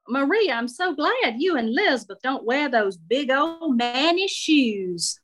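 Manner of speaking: high, gushing Southern matron, drawing out the last words; unfiltered older Southern lady. Acted. Shirley compliments Marie on her shoes, tactless gushing Southern lady.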